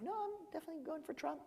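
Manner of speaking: a quick mock of the cab driver's firm answer; imitating the driver. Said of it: "He was like".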